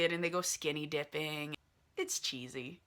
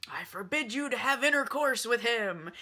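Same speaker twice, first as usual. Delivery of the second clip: mock-stern father, raised pitch; hammed-up, higher-pitched prohibition; stern forbidding; 5 semitones higher, 4 dB louder than usual. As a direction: lay down the law as an overprotective dad. Father forbids daughter in retold quarrel.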